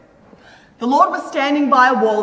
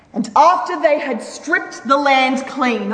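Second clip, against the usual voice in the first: usual speaking voice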